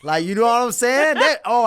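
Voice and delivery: loud, high, hyped-up rush. Real, not acted. Telling the host about the pay, a loud hyped rush. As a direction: sell how hyped it made him.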